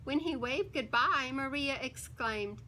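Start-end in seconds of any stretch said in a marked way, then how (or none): none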